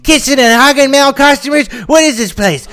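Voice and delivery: very high, indignant customer voice; outraged complaint. A narrator's reading. Secret customer complains to manager about staff, outraged.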